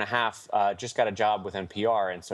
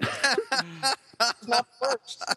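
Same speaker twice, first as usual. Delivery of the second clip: slow high drawl; 13 semitones higher, much slower than usual. Acted. Repeated mock consolation.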